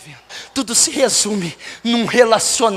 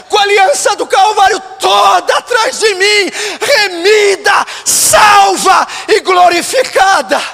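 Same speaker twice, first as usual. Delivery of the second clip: impassioned preaching; 12 semitones higher, 8 dB louder than usual. Real, not acted. Pastor pleading with God for the lost, impassioned preaching. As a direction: preach at full force, stretching the key words.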